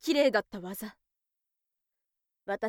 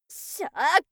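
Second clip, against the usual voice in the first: loud, higher fighting yell; fighting shout; 5 semitones higher, 8 dB louder than usual. Acted. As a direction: grit it out as you grab him. Attacking in the fight.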